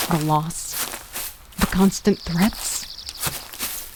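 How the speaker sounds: slow, soft whisper trailing off; fading whisper. A narrator's reading. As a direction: let it die out, numb. Narrator fades into numbness.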